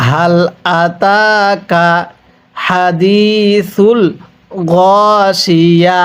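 "আতাকা" drawn out very slowly for repeating; slow recitation model. Shown as a demonstration. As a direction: model each word slowly for the class to echo. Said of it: "আরেকবার পড়ুন". (ধীরে টেনে শিখিয়ে পড়া)

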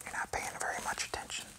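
soft whispered aside to a distracted little one; gentle whispered tease. Real, not acted. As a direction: breathe it as a whisper, no voice.

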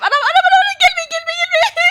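cornered player yelling at the monster; panicked shouting. Real, not acted. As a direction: scream it as it catches up. Monster closing in.

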